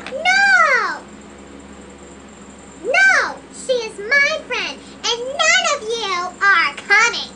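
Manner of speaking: loud, bratty shouted refusal; angry shouting. Acted. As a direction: yell it like a spoiled brat. Lola refuses to share the invitation.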